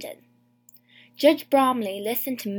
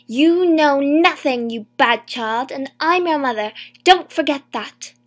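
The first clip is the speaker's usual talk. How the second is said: furious shout; 3 semitones higher, 10 dB louder than usual. A narrator's reading. Mother screaming at her daughter in court, furious shout. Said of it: "shouted at me".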